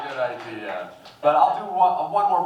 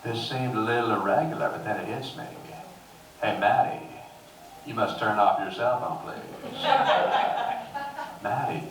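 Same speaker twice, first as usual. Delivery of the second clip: Matthew McConaughey; 4 semitones lower than usual. Retold or imitated. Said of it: "It's Matthew McConaughey".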